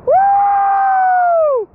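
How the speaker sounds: high shouted whoop; celebration whoop. Real, not acted. Angler landing his giant perch, whooping for joy. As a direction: let out a joyous whoop.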